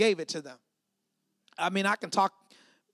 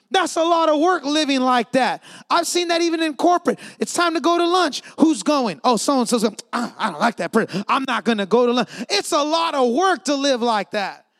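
full-force preaching; 3 semitones higher, 8 dB louder than usual. Real, not acted. Preacher to congregation on unforgiveness, full-force preaching. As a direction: drive the point home loudly.

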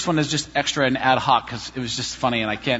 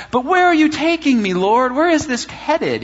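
high, louder pleading; pleading with God; 10 semitones higher, 4 dB louder than usual. Retold or imitated. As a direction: beg God for answers, exasperated.

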